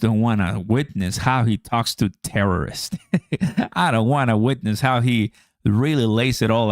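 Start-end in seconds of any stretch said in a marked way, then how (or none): 3.0-3.7 short high laugh. Real, not acted. Laughs at imagined scene.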